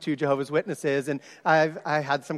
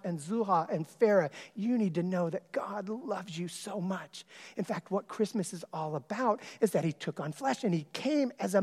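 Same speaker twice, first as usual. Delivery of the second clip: tender reassurance; 5 semitones higher, 4 dB quieter than usual. Retold or imitated. Telling girls God loves them.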